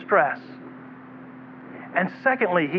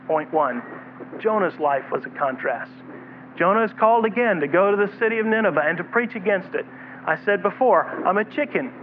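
usual speaking voice

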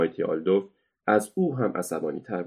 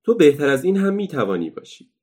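higher, louder teasing encouragement; encouraging; 7 semitones higher, 7 dB louder than usual. Acted. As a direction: cheer on the beaten strongman. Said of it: "برای تشویق مایتی آلدوف گفت".

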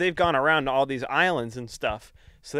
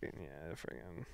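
low, much softer mumbled shrug; mock nonchalant shrug; 3 semitones lower, 9 dB quieter than usual. Kyle leaving the hostages behind, mock nonchalant. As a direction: toss it off like you don't care. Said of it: "I'm gonna be like".

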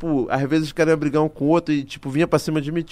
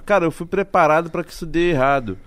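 normal talking voice

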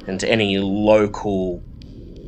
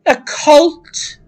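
high, louder accusing question; suspicious librarian; 7 dB louder than usual. Acted. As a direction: pin her down with it. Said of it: "she says".

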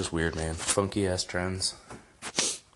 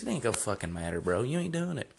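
usual speaking voice

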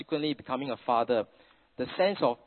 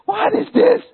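loud, much higher baffled cry; baffled outcry; 13 dB louder, slower than usual. Retold or imitated. Parents baffled by son's rice meeting, loud high cry. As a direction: blurt out total bewilderment. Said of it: "we scratch our head and we say".

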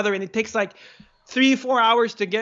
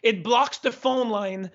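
normal talking voice

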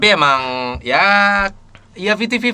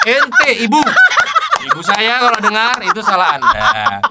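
high, slow, talked through laughter; can barely get the words out for laughing; high laughing retelling of the story; laughing while talking; 12 semitones higher, 4 dB louder, much slower than usual. Real, not acted.

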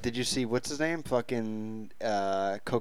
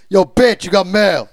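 much louder, higher crude shout; mock yell; 5 semitones higher, 14 dB louder than usual. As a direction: bark the line as an insult. Shouted parody of movie line.

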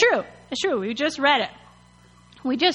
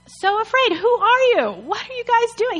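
hammed-up outrage, pitched way up; offended; 10 semitones higher than usual. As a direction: scold them in disbelief. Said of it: "He's offended".

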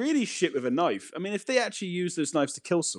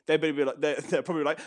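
normal talking voice

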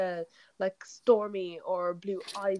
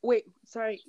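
usual speaking voice

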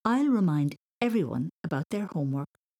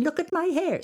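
mock vain girl; 11 semitones higher, 3 dB louder than usual.

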